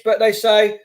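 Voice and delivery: ordinary talk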